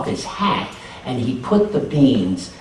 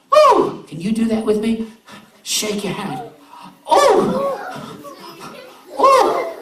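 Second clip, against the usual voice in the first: delighted squeals; 4 dB louder than usual. A narrator's reading. Neighbors dancing along.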